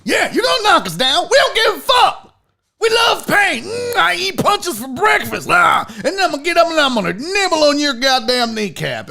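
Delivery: fired-up coach rant, fast and forceful; hammed-up pep talk racing along; Dan Campbell. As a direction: rant like a crazed football coach. Mocking impression of fiery coach Dan Campbell.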